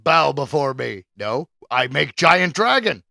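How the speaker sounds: slow, loud boastful god; booming demon lord. Retold or imitated. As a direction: demand worship like a cartoon deity. Mocking God demanding worship.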